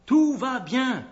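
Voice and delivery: lancer haut et fort au roi; cri d'annonce. A narrator's reading. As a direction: crie la bonne nouvelle de loin. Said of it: "Achimaatz cria". (cri de messager)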